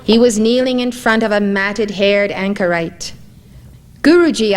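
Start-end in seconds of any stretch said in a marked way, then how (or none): 4.0-4.6 a sudden glad cry of recognition, higher and louder; startled, joyful exclamation; exclaiming. Acted. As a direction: call his name out in surprise. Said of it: "I exclaimed".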